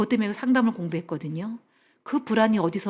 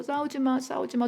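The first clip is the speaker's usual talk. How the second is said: higher, softer mock-tearful plea; tearful pleading; 4 semitones higher, 6 dB quieter than usual. Daughter trying to stop her parents' fight, lightly tearful pleading. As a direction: imitate a child begging weakly. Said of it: "울면서".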